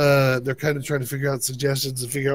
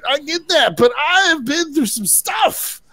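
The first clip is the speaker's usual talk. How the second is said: higher-pitched, mock-exasperated Jon; hammed-up Jon venting at her; 11 semitones higher than usual. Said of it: "It's like".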